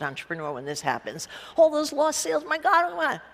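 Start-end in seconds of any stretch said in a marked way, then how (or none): 1.6-3.2 mock panic. Entrepreneur panicking over lost sales, mock alarm. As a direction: throw up your hands in fake alarm.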